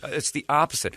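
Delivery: ordinary delivery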